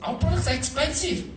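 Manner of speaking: a laughing confession that the products cost a lot; laughing admission. Shown as a demonstration. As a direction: own up to it, laughing. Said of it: "But I hate to say we".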